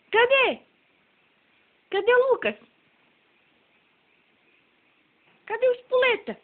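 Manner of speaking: high squeaky peekaboo. Real, not acted. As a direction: ask high, like hunting for him. Peekaboo with baby. (esconde-achou com o bebê)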